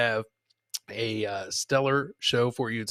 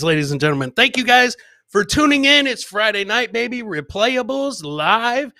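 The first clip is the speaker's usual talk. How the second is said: hype announcer; 10 semitones higher, 7 dB louder than usual. Acted. Host welcoming the stream audience, hyped announcer voice. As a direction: pump up the live chat like a show announcer. Host hypes opening of live show.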